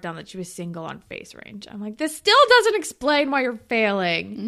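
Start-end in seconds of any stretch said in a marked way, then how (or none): 2.0-4.5 loud exasperated telling-off; a loud, fed-up telling-off; mock scolding. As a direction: chew him out in frustration. Scolding Dave again.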